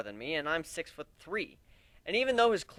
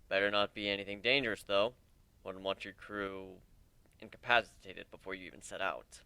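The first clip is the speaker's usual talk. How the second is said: Aoi character voice; 7 semitones lower, 5 dB quieter than usual. Acted.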